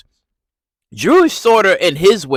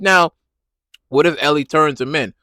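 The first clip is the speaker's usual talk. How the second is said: ordinary talk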